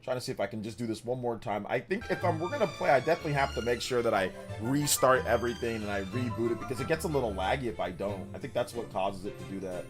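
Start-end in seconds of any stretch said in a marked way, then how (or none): none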